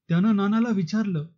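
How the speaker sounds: usual speaking voice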